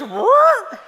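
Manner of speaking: high squeaky cartoon yelp of disbelief; Scooby-Doo what. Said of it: "My Scooby-Doo ear goes".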